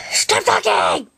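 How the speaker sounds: high, sharp cartoon raccoon yell; Rigby impression. Voicing Rigby for a YouTube impressions video, high and sharp. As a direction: snap it like the Regular Show raccoon. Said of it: "The first one is Rigby for regular show".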